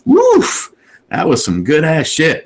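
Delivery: high overplayed woof of fake delight; fake enthusiasm. Pretending to love friend's scotch, hammed-up praise. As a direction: sell it like the best actor ever. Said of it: "best actor you've ever seen".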